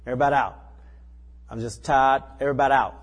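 loud, firm "Everybody out"; a fed-up landlord's order; pastor ordering out. Retold or imitated. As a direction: clear the room with authority.

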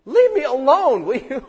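high, loud, fed-up plea; a person pestered by God snapping back; exasperated plea. Retold or imitated. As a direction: shove the hounding away in mock annoyance. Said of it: "he will, like".

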